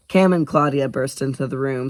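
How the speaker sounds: usual speaking voice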